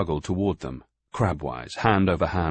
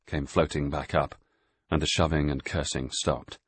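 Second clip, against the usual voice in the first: usual speaking voice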